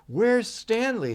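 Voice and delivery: put-on high, slow child's voice; worried child. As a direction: ask like an anxious little boy. Boy searches the house.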